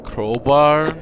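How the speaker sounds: ordinary talk, nothing marked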